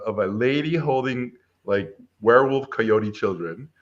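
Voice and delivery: ordinary delivery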